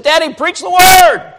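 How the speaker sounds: high, loud shouted plea; son's plea. Retold or imitated. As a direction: shout it like the boy begging his father. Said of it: "one of my boys said".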